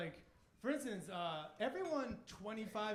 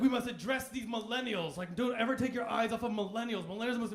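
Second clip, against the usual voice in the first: louder panicked warning voice; mock urgent announcement about millennials; older folks fretting loudly; alarmist elder; 3 semitones higher, 8 dB louder than usual. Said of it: "everyone's like".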